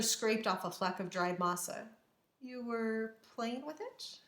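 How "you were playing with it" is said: slow, soft, rising question; gently smiling. A narrator's reading. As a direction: ask tenderly with a smile. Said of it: "smiling gently".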